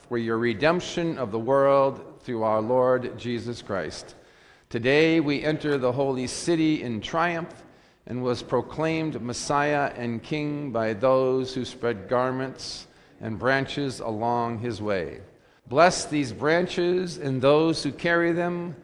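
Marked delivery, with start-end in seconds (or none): none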